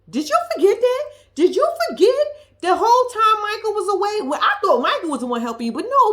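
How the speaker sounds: loud, high, fired-up scolding; heated rant. Real, not acted. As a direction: lay into her loudly, pitch rising. Ranting at Justine directly.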